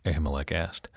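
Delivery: usual speaking voice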